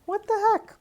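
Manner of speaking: high, loud, baffled "what the heck"; exasperated surprise. Real, not acted. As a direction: yelp it at the cracked cheese. Cheese cracking on camera.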